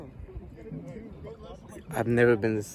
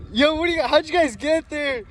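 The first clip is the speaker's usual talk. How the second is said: surprised; 6 dB louder than usual. Real, not acted. Shocked at friends out on water.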